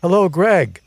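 high, loud happy call across the clearing; yelled greeting. A narrator's reading. Sherry greeting Greg as he arrives, loud high yell. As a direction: call out his name from a distance. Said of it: "appears from within and yells".

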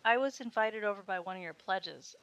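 nervous halting start, repeating I; stammering. A narrator's reading. Pledge stammering to the sorority girl at the door. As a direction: trip over the first words, flustered. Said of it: "I stammered".

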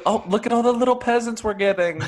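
high, delighted pirate cry; gleeful excitement. Acted. Pirate spotting peasants to plunder, high-pitched glee. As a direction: coo over the haul in a high voice.

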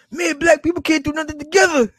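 very high-pitched heated imitation, amused; mock heated. Vlogger imitating a heated man's complaint, high mock outrage. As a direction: mimic his worked-up complaint, close to laughing. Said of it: "He's heated".

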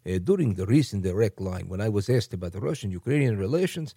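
low, accented Putin impression; mock Putin reading his own article aloud. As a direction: read it like Vladimir Putin giving an address. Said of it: "let me do this in my Putin voice".

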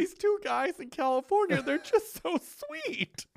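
very high mock-sobby gushing, drawn out at the end; tearful listener. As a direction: wail it sweetly in a squeaky voice. Acting out tearful podcast listener.

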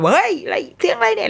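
mild mock startled yell; startled shout. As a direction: jolt awake and call out. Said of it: "ตะโกนขึ้นมา".

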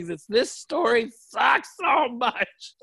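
high, drawn-out mock wail; hammed-up cry of despair at the book; exasperated reader. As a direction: stretch it out in a comic high shout. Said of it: "you could just tell".

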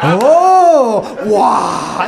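hammed-up tourist marvelling at a doorknob; amazed Korean accent. Wife marvelling at a doorknob, in a put-on Korean accent. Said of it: "she acted like she'd never seen a doorknob".

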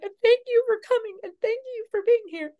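rapid, very high weepy thanks; imitated crying. Thanking students for coming, imitated crying. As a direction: gush through pretend tears, racing and squeaky. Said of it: "crying and I'm like".

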